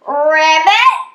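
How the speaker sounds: loud, perky ribbit with a lift; pig's ribbit. A narrator's reading. Little pig answers the frogs, loud perky ribbit. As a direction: chirp a confident frog call. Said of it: "the little pig answered".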